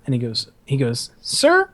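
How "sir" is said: very high questioning squeak; confused cadet. Retold or imitated. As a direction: imitate a baffled recruit. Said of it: "like, a confused look on his face".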